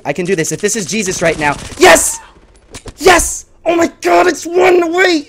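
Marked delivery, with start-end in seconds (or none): 1.8-5.2 high, loud triumphant cries; thrilled shouting. Real, not acted. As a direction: yell with real nail-biting excitement. One kill from winning.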